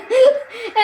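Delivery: quick high laugh burst; short laugh. Real, not acted. Gamer laughing at a wild header, short laugh. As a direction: laugh it out in disbelief.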